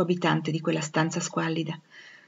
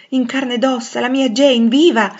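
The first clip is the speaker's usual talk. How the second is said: high exclamation, building louder; joyful amazement; 6 semitones higher, 8 dB louder than usual. Acted. Rochester finding his Jane alive, joyful amazement. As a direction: build to an exultant cry.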